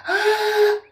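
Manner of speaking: loud high mock roar of a D nine; loud imitated engine roar; bulldozer roar. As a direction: belt out the machine's scream.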